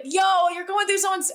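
high-pitched, incredulous protest; indignant outburst. Retold or imitated. Podcaster objecting to someone reading a private sketchbook, indignant. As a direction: exclaim it high, as if appalled at the snooping.

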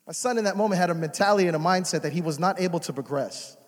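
ordinary delivery

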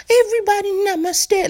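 high airy mock-sweet voice; mock namaste. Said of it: "He ain't built to be like".

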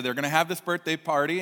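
ordinary delivery

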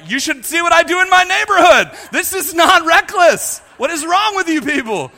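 loud, high-pitched comic outrage; mock indignant protest. Driver arguing with cops over reckless driving, indignant. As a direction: argue back hotly, playing it for laughs.

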